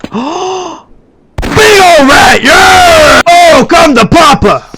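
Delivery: drawn-out high, loud roar; frenzied battle yell. Real, not acted. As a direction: bellow a wild challenge at the enemy. Finally meets and fights big rat.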